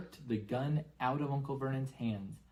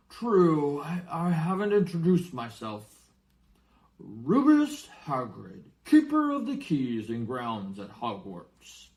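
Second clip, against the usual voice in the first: louder, higher giant, slowing for his name; Hagrid; 4 semitones higher, 8 dB louder than usual. Acted. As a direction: proudly introduce yourself as a giant. Hagrid introduces himself.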